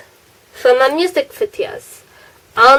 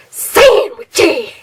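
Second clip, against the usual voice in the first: weird voice; 4 semitones higher than usual. Shown as a demonstration. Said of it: "said it in a weird voice".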